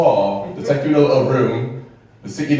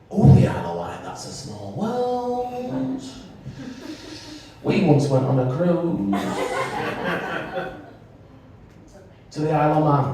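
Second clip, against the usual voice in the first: mimicked small-talk response; 5 semitones higher than usual. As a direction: stretch it out in a put-on chatty voice. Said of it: "That would be like me going".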